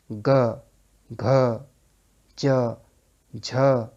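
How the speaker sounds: ग, घ, च, झ each said alone, loud and clear, to feel the voice box hum; voiced consonants sounded out. Shown as a demonstration. Teacher sounding out voiced consonants for students, clear and loud. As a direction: sound out ग, घ, च, झ one by one with full voicing. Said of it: "आप चाहें तो देख लें".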